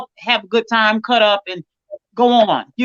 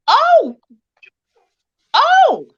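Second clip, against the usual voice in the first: high, loud squeal of surprise; delighted high-pitched gasp of oh; surprised oh; 9 semitones higher, 5 dB louder, much slower than usual. Real, not acted. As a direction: caught off guard, pitch jumps way up. Surprised at a poem sent.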